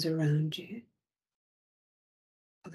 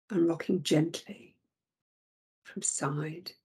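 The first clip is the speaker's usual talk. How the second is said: normal talking voice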